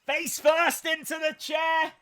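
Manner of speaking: high, loud play-by-play shout; excited call. Real, not acted. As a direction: hit the call as the move lands. Commentating a big spot.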